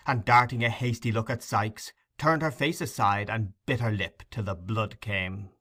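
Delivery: ordinary delivery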